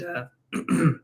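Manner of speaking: a sudden squeak in the voice. Real, not acted. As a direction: voice cracks up into a squeak. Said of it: "did you hear my voice squeak?".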